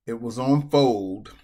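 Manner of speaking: loud, slow, furious blurt; angry outburst. Real, not acted. Poker player to viewers about the misclick, loud and angry. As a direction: spit it out in disbelief. Accidental fold costs big hand.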